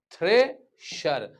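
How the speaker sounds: "थ्रेशर" sounded out as थ्रे, then शर; demonstrated pronunciation. Shown as a demonstration. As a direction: split "थ्रेशर" clearly into its two syllables. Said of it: "इससे उच्चारण". ("थ्रेशर" का उच्चारण थ्रे और शर में तोड़कर)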